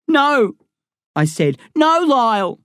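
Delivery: put-on boy clinging to Lyle at the door, tearful; tearful pleading. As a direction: beg him not to go. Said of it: "Tears in my eyes".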